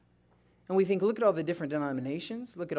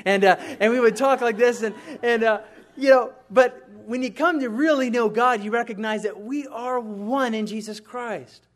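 loud, high, driving sermon voice; preaching at full force; 5 semitones higher, 8 dB louder than usual. Acted. Pastor preaching unity to the congregation, loud and high. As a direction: preach it out, rising with conviction.

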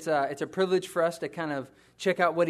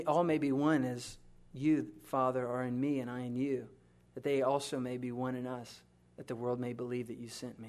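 softer, hushed scripture reading; quiet reverent reading; 5 semitones lower, 5 dB quieter than usual. Acted. Pastor rereading Jesus' prayer to the church, hushed and reverent. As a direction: read the prayer gently, almost to yourself.

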